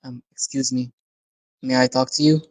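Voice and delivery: soft, tentative request; cautious. Acted. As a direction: approach carefully, as if interrupting something important. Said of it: "as cautiously as she approached Casey".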